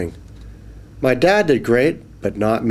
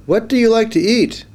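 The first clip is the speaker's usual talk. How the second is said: high curious fish; 12 semitones higher, 3 dB louder than usual. Acted. Fish asking the boy what he eats, high and curious. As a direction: ask like a curious tiny fish. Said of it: "it said".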